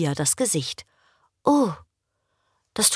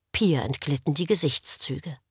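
ordinary delivery, nothing marked

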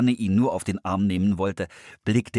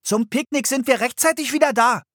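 much higher and louder call to parents; 6 dB louder than usual. A narrator's reading. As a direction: reassure parents from the doorway. Ruft den Eltern nach. (Philipp ruft den Eltern beruhigend zu)

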